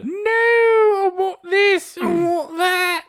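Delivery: put-on very high, slow, whiny old woman voice in an English accent. Friend interrupts the order as a whiny old English woman. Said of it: "very old woman English accent".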